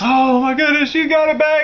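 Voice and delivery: thrilled, amazed relief; wife squealing with surprise; delighted wife. Retold or imitated.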